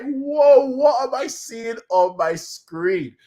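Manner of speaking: high, loud, slowed-down disbelief; stunned whoa. Retold or imitated. Fan reliving watching the episode, stunned disbelief. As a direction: let the jaw drop. Awed reaction to finale scene.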